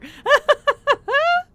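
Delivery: a giggle that climbs up high; high laugh. Real, not acted. Still laughing at the mistake.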